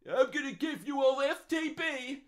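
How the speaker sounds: slow, high, loud politician impression; hammed-up politician's promise, pitched high and drawn out; John Howard. Mimicking a politician promising something to everyone, John Howard impression. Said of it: "I sounded like John Howard".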